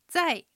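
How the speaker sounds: 在 drawn out with a falling tone; falling fourth tone. Shown as a demonstration. Teacher checking tones for learners, 在 as a falling fourth tone. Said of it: "下がる四声".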